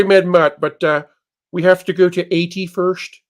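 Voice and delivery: usual speaking voice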